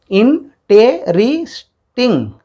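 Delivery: "इंटेरिस्टिंग" very slow, in four separate beats; syllable by syllable. Shown as a demonstration. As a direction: stretch "इंटेरिस्टिंग" out with a gap after each syllable. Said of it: "बोलिए". ("इंटेरिस्टिंग" को धीरे-धीरे चार हिस्सों में तोड़कर बोलें)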